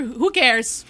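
ordinary delivery

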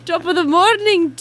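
high, loud hammed-up Irish accent. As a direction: shout a jokey Irish greeting. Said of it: "say yay in an Irish accent".

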